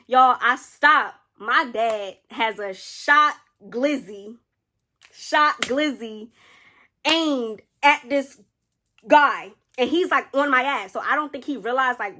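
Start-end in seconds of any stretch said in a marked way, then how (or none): 0.1-9.5 drawn out very slowly, louder, word by word; slow dramatic reveal. Acted. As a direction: let each word land slowly like a big reveal. Dramatic reveal of dad's gun.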